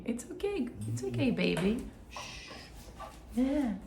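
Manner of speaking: calm him with gentle coos; soothing a scared dog. Real, not acted.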